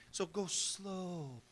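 slow, hushed scheming aside; scheming Satan. Acted. Satan plotting to reel in the fish, slow and hushed. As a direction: coax it out quietly and slowly.

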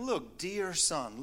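soft, slow "Look, dear son"; tender father. Retold or imitated. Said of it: "His father said to him".

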